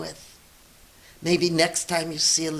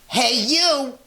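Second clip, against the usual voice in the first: high, loud rude call; grouchy ladybug; 8 semitones higher, 6 dB louder than usual. Acted. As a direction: bark it at the yellow jacket. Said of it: "said the grouchy ladybug".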